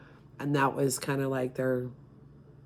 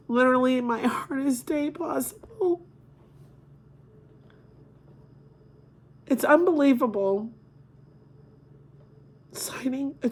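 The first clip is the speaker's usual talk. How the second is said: real crying; 8 semitones higher than usual. Real, not acted. Mother telling viewers about her son's death certificate, crying. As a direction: voice breaking high and tight, words half choked.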